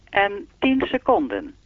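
even, measured time announcement; speaking clock voice. Acted. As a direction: read the time like the recorded clock. (zoals de sprekende klok)

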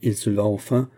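plain reading voice

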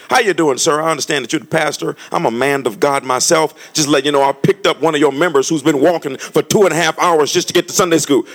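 loud, bold address to a pastor; loud imagined pastor greeting. Retold or imitated. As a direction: announce yourself proudly to the pastor. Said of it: "roll down the window and say".

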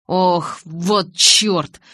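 loud, drawn-out curse; forceful cry of annoyance; pained exclamation. A narrator's reading. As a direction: curse out loud at a fresh cut. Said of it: "воскликнул Джейкоб".

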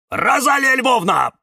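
loud, high-pitched call; shouting. A narrator's reading. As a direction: shout the name across to someone. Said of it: "крикнул кактус Кирилл".

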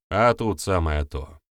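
ordinary delivery, nothing marked

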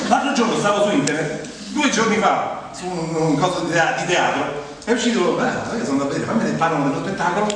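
normal talking voice

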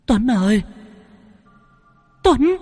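high urgent shouting of the name; shouted call. Acted. Spirit shouts a warning to Tuấn, high urgent call. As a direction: yell his name in warning. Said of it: "vang lên tiếng quát".

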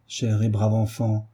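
usual speaking voice

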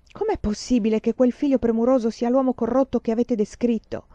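ordinary delivery

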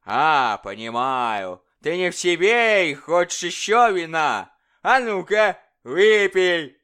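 loud, high, mocking tyrant king; bully the dwarf like a drunken king; boisterous royal bully demanding fun. King voiced in story.

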